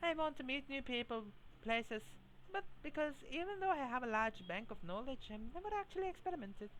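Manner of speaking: high, lightly put-on Scottish accent for the idol; wistful idol in a mock Scottish accent; bad Scottish accent. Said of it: "her Scottish accent and a very bad one at that".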